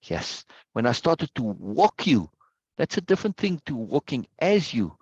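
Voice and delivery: ordinary delivery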